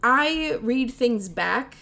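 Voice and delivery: ordinary talk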